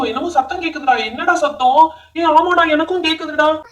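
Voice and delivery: ordinary talk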